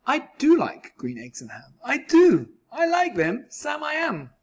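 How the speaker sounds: rising, joyful surprise; suddenly thrilled to love them; bursting with delight. Acted. Delighted discovery of liking them.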